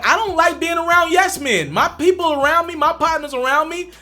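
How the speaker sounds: ordinary talk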